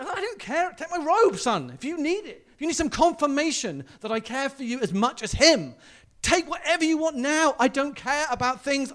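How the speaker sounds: impassioned father. Retold or imitated. Father telling the older son, loud and impassioned. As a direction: pour out love at full voice. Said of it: "He, he says here".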